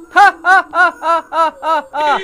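loud, high forced ha-ha of bravado; loud boastful mock laughter; taunting laugh. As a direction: cackle at the ghost to fake courage. Mocking the ghost after hiding.

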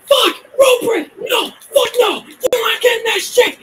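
high, loud raging screams; shrieking at a game opponent; upset screaming. Real, not acted. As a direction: scream it in repeated furious bursts. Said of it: "Why I'm screaming is because I'm getting really upset".

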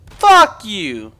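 1 angry curse. Real, not acted. Cursing at game character.

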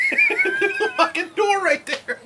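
slow and high through laughter; laughing. Real, not acted. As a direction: laugh the words out. Laughing at the video.

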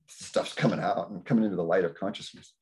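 usual speaking voice

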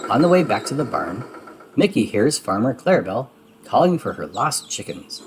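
ordinary delivery, nothing marked

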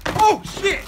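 sudden high shout of alarm; startled yelp. Real, not acted. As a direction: caught off guard, yelp it. Slips on the stairs.

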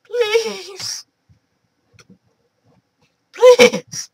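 whiny high plea; high begging please. Real, not acted. Gamer begging the game for help in a high voice. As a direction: beg in a thin high voice.

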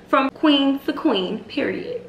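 high, slow and proud; proud sign-off. Real, not acted. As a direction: crown the listener, slowly. Sign-off to her viewers.

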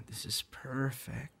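whisper. Acted. Settling at picnic spot.

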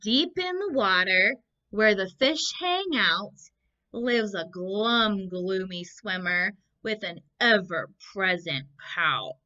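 put-on lilting read-aloud for children; story reading. A narrator's reading. Teacher reading the book aloud to the class, lilting. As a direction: read it like a picture book to little ones.

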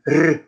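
R as a long tongue trill; rolled R. Acted. Dragon showing off to Sams, long rolled R. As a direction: trill the R proudly. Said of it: "weil ich das jetzt so gut sprechen kann".

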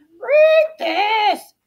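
high, loud squawk; squawking crow. A narrator's reading. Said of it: "she squawked".

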